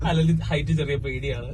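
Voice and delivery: laughing. Real, not acted. Joking about shaking the gondola.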